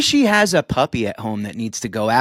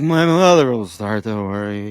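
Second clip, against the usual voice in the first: put-on town drunk; 5 semitones lower than usual. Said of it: "starts slurring her words".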